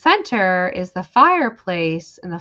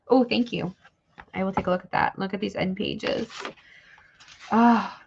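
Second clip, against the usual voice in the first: ordinary delivery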